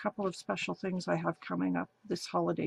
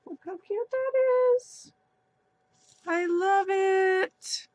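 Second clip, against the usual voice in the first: delighted squeal; 11 semitones higher, 5 dB louder than usual. Real, not acted. Opening birthday gift from friend.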